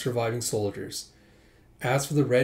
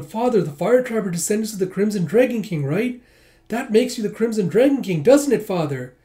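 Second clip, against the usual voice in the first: put-on higher, louder boyish voice; eager child; 8 semitones higher, 6 dB louder than usual. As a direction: ask proudly like a little boy. Child voice in flashback.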